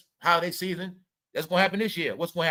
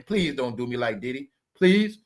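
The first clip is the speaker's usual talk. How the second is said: mock begging; 7 semitones lower than usual.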